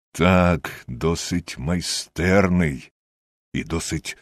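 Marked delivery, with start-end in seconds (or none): none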